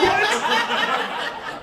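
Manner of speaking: high-pitched comic yelp of disbelief; mock shock. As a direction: exclaim it way up high, playing stunned. Acting out shocked reaction.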